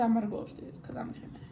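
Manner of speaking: fast hushed whisper; whispered aside. Real, not acted. Telling someone off camera she's going upstairs, whispered. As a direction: whisper it quickly, off to the side.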